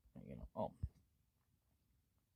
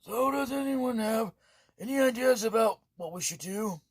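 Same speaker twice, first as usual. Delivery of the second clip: higher, louder appeal to the group; urgent call; 9 semitones higher, 10 dB louder than usual. Acted. Leader asking the party for ideas, higher and louder. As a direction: call out to everyone for a plan.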